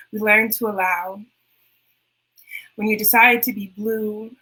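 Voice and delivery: unhurried spoken-word recital; poem reading. Acted. Reads her last poem.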